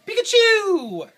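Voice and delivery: long, high, slow Pikachu squeal; Pikachu cry. Retold or imitated. Husband imitating Pikachu for his wife, high squealed cry. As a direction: belt out the Pokemon's name like the creature itself. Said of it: "and he goes".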